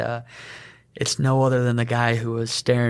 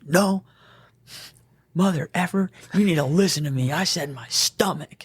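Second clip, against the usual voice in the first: higher, insistent retort ending in a hushed hiss; angry patient; 5 semitones higher than usual. Retold or imitated. Patient snapping at the medic, mock angry. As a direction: snap back like a fed-up patient.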